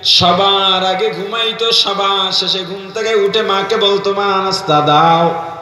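low, plaintive sing-song narration; melodic preaching. Real, not acted. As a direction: intone the bride's hardship low, lingering on endings. Preacher intones bride's story. (নিচু করুণ সুরে ওয়াজ)

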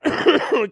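loud, high laughing fit; high-pitched laugh; high burst of laughter. Real, not acted. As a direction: crack up helplessly. Laughing at testicle painting.

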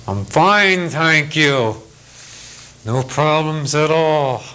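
bottle the anger behind a curt fine; tight-lipped fine. Retold or imitated. Older pilot insisting he's fine while being provoked, through clenched teeth. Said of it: "you could tell he had his clenched teeth".

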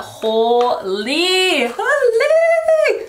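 drawn-out, amazed holy; excited. Real, not acted. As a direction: gush over it, squealing high. Amazed at the suit colour.

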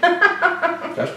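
high, slow words through a laugh. Real, not acted. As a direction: say it while laughing. Laughs while tasting.

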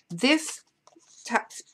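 interrupted by hiccups; speaking with the hiccups. Real, not acted. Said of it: "excuse me, I have the hiccups now".

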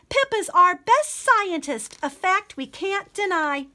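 cheering crowd. Acted. Kingdom cheering Pippa.

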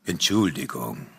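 low, unruffled figure voice; stranger's deep voice. Acted. Intruding man apologising to the couple, deep unruffled voice.

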